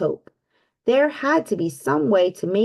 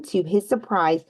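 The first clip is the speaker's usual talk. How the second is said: usual speaking voice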